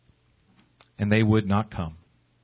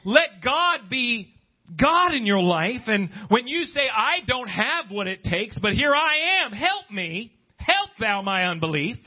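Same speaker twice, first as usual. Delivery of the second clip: preaching; 10 semitones higher, slower than usual. Acted. Preaching at full force.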